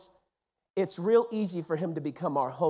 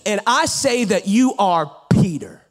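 loud, slowed proclamation; full-force preaching; 4 semitones higher, 7 dB louder than usual. Acted. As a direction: declare it from the pulpit. Preaching Jesus's promise loudly.

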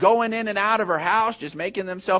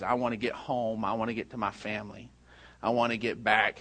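very soft, low retold longing; hushed, understated sadness; retold tearful plea; 7 semitones lower, 8 dB quieter than usual. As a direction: quietly voice a distressed mother's plea. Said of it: "she's just crying".

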